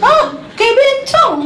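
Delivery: high, stretched-out comic shriek; mock horrified yell. Husband sees wife's hair extensions, mock high yell. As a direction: imitate a husband yelping in shock. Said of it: "Dia teriak".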